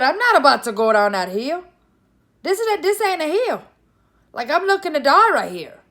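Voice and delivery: high, loud protest at the cliff edge; alarmed refusal. Retold or imitated. Rider balking at the steep hill, alarmed refusal. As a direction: refuse loudly, voice jumping up in mock panic.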